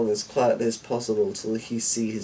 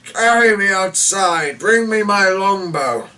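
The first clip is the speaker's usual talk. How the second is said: put-on old lord suddenly loud and high, mustering his strength; rallying call; 9 semitones higher, 8 dB louder than usual. A narrator's reading. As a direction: summon the last of your strength and call out. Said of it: "rallying himself considerably".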